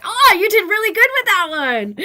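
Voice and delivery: high, loud, delighted praise; imitated excited pupil. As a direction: gush like an impressed kid. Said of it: "and he's like".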